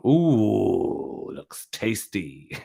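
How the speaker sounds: slow, drawn-out teasing ooh; mock relish. Streamer teasing the new thumbnail, slow mock relish.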